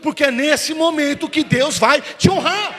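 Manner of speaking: high, loud, slowed-down preaching cry; preaching shout. Acted. Pastor promises the congregation God's honour, in a slow loud shout. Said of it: "repeated line, bigger".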